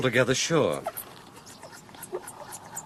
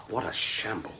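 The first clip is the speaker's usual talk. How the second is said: whisper; 4 semitones lower, 10 dB quieter than usual. Acted. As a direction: whisper it in the dim room. Whispering inside derelict cottage.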